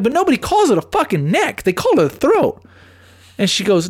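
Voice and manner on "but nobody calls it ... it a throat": higher, insistent argue voice; heated retold argument. Host retells his neck-versus-throat fight with his girlfriend, heated and higher. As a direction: push back hard on her, retelling.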